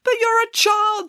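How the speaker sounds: high-pitched, scolding astonishment; emphatic declaration. A narrator's reading. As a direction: declare it in righteous alarm. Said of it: "declared Hannah".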